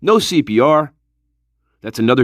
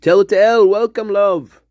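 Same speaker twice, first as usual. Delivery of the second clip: normal talking voice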